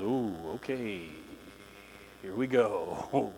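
low, soft, slow uneasy murmur; hushed mock dread; wary congregation. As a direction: groan it like a wary crowd. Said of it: "everybody in the room goes".

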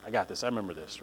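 hushed self-reassurance; quiet inner voice. Retold or imitated. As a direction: murmur it like remembering. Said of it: "Hopefully this will be like".

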